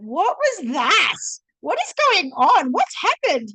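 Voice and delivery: high, loud startled cry with a laugh; shocked laughing shout. Retold or imitated. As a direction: blurt it out, stunned and laughing. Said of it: "And I was just like".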